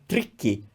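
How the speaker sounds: "tricky" with a clean t and rolled r; Italian reading. Shown as a demonstration. Teacher reading that word in Italian, "tricky" with rolled r. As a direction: read "tricky" by Italian rules, rolling the r. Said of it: "If I was reading that word in Italian".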